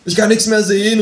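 high, distressed cry; calling out. A narrator's reading. Dazzled man calling out to his companion. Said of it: "rufe ich".

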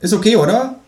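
high, smug kangaroo voice; cheeky kangaroo. A narrator's reading. Känguru figure voiced high.

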